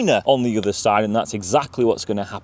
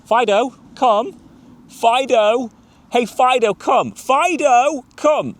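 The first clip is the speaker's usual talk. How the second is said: high, louder recall calls, building in urgency; insistent dog calling; 13 semitones higher, 5 dB louder, slower than usual. Acted. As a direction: keep calling a dog that ignores you. Repeated calls to dog not returning.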